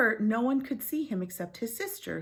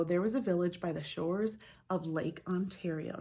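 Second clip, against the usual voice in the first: ordinary delivery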